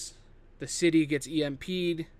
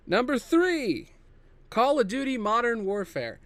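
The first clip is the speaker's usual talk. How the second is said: drawn-out, higher, louder countdown call; dramatic announcement; 7 semitones higher, 3 dB louder than usual. Real, not acted. Host announcing the next pick to viewers, drawn-out dramatic call. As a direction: announce it like a big reveal.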